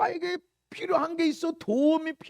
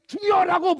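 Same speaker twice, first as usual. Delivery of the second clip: loud, higher prayer shout; crying out; 4 semitones higher, 7 dB louder than usual. Shown as a demonstration. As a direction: burst out calling on the Lord. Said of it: "부르짖어". (크게 부르짖는 소리)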